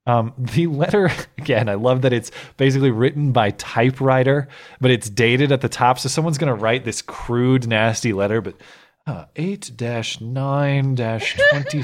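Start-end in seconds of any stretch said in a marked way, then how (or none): none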